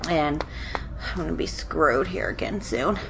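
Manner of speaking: nasally, blocked-nose voice; talking through a stuffy nose. Real, not acted. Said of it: "She's all nasally and".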